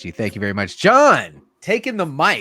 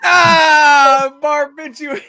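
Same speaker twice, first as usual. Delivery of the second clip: laughing groan; 7 semitones higher, 7 dB louder, much slower than usual. Real, not acted.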